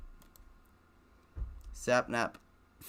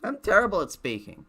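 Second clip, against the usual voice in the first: mock frustration; 11 semitones higher, 9 dB louder than usual. Real, not acted. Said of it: "Just plain awful at speaking".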